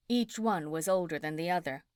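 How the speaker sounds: plain reading voice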